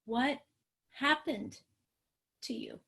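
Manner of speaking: halting, word by word, fading to a whisper; careful questioning. Shown as a demonstration. Parent stepping back to ask a child, softly wondering. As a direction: ask it gently, pausing between words. Models a gentle trauma-informed question.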